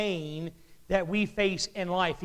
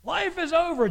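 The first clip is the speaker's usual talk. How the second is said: mock bawling kid; 10 semitones higher, 4 dB louder than usual. Kid who dropped an ice cream cone, mock wailing. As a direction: imitate a child's overblown despair. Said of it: "like your kid does when they drop their ice cream cone".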